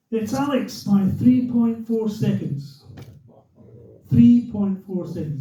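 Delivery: slow, deliberate result announcement; radio commentator. Retold or imitated. Commentator naming Alex the winner, imitated slow announcer. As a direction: imitate a commentator spelling out the margin. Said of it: "He's the radio commentator".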